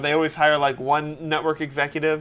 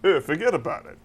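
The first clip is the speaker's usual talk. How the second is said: Rodney Dangerfield impression; 6 dB quieter, faster than usual. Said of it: "shifted into Rodney Dangerfield".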